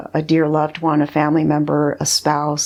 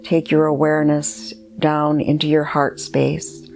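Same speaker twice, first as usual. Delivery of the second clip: guided meditation. Acted. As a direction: guide the listener gently inward, leaving space between phrases.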